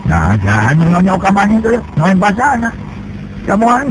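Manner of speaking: plain reading voice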